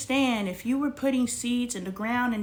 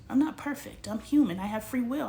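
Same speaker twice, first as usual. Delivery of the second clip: ordinary talk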